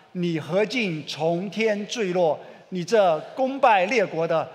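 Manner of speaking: slow, loud group recitation of scripture; scripture recited together. Acted. Preacher leading the congregation in reading Isaiah, slow loud recitation. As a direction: read it out slowly and loudly with the whole church. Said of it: "一起来念，来".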